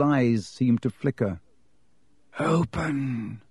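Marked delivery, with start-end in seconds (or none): 2.4-3.4 a soft, low hissed Open; faint hiss. A narrator's reading. Harry speaks to the stone serpents in a soft hiss. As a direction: hiss it like a snake. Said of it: "in a low, faint hiss".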